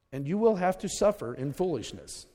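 ordinary delivery

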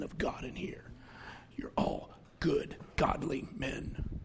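soft whisper, slowing at the end. Real, not acted. Preacher whispering to the men of the church. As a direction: draw the men close with a slow whisper. Preacher hushing his point.

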